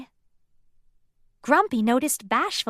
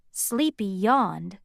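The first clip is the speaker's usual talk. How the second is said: ordinary delivery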